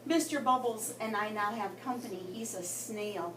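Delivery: ordinary delivery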